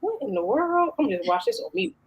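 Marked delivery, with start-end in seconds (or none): 0.0-0.9 higher, softer mock Baltimore exclamation; lightly imitated Baltimore accent, pitched up. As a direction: imitate an astonished Baltimore YouTuber. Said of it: "She has a Baltimore accent too".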